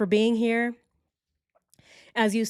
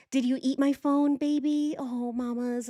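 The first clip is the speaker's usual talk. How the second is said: higher doting voice to a pet; 6 semitones higher than usual. Real, not acted. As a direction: coo at your cat. Talking to her cat.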